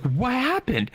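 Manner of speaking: high-pitched mock fiancee question; imitated alarm. Fiancee asking what happened, imitated alarm.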